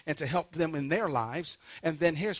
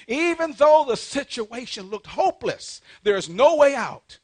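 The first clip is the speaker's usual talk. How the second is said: loud, raised pulpit cry; fervent preaching; 7 semitones higher, 10 dB louder than usual. Real, not acted. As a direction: proclaim it high and forcefully. Preacher at emphatic peak.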